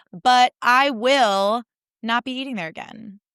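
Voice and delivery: ordinary talk, nothing marked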